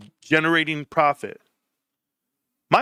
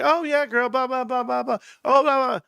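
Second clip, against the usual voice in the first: high-pitched mock phone gossip; put-on high girly gossip voice; gossiping wife; 9 semitones higher than usual. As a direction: imitate a wife chattering to her girlfriend. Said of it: "on the phone the whole time gossiping".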